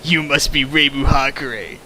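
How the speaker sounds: usual speaking voice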